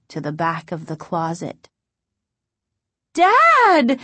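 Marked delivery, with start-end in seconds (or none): 3.1-4.0 high, loud drawn-out cry. A narrator's reading. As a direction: call out in shock at a discovery. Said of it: "she cried".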